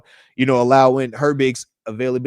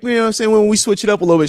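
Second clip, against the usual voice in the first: usual speaking voice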